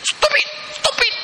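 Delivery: high-pitched mock telling-off in English; imitating scolding wife. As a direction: plead and scold, rising to a squeaky "stop it". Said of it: "Marah bini dia".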